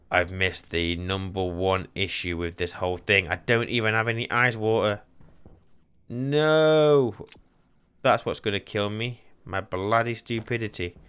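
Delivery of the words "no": long, drawn-out loud 'no', higher; dismayed cry. Real, not acted. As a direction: wail it in dismay. Dismay at realising no water.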